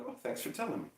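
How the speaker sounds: hushed acknowledgement, acted out; retold softly spoken thanks; soft considerate reply. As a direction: answer gently for someone with a headache. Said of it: "And I'm like".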